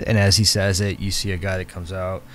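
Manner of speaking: ordinary talk, nothing marked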